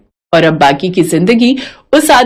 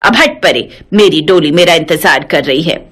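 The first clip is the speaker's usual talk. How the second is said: put-on lower, scolding old mother-in-law; 7 semitones lower than usual. Mother-in-law scolding her daughter-in-law, lower feisty voice. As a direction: play the feisty old saas brushing her off.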